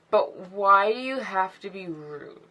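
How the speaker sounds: slow, dragged-out question with an odd voice; odd drawn-out delivery. Real, not acted. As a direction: stretch every word out, strangely. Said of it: "I don't know why I said it like that".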